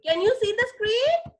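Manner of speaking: plain reading voice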